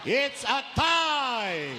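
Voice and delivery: loud, drawn-out shout; shouted punchline. Acted. Priest shouts the joke's punchline to the congregation, loud and slow. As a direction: belt it out slow like a referee's call.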